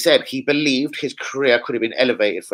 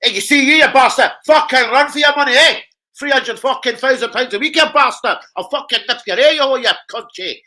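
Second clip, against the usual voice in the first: higher, louder mock Scottish rant; imitating Ferguson ranting; 8 semitones higher, 6 dB louder than usual. Gaffer ranting at a lazy player, hammed-up angry shout. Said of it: "Lee Akers is a bit like Ferguson".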